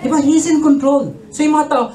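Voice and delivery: ordinary delivery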